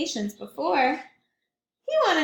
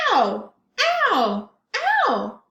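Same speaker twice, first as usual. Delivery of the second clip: playful louder ouch cries; mock ow; 5 semitones higher, 6 dB louder than usual. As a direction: hop on hot sand. Penguin on hot sand.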